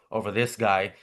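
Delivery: ordinary talk, nothing marked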